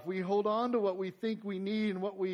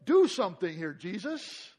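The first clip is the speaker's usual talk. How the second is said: mock instructing, higher and louder; bossy disciples; 6 semitones higher, 7 dB louder than usual. Disciples telling Jesus what to do, bossy. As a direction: play the disciples ordering Jesus around. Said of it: "disciples kind of instructing Jesus".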